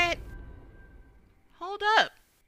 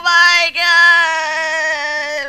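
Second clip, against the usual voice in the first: shrieking jackpot winner; 2 semitones higher, 21 dB louder, much slower than usual. Real, not acted. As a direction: shriek it out, stretching every word. Shrieks at game moment.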